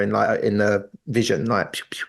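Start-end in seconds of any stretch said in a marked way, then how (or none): 1.7-2.0 soft blaster sound effect. Retold or imitated.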